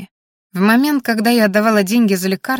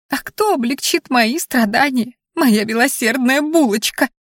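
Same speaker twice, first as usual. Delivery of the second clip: higher, drawn-out sarcastic complaint; spiteful exhale; 5 semitones higher than usual. A narrator's reading. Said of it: "зло выдохнула Анфиса".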